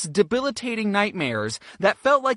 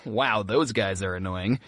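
low, slow, dry thought; unimpressed aside; 12 semitones lower, 3 dB quieter than usual. Retold or imitated. Tourist judging fellow chanters, low unimpressed aside. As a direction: drop the pitch right down and slow up.

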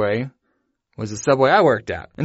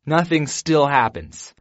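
ordinary delivery

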